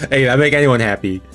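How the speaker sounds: ordinary talk, nothing marked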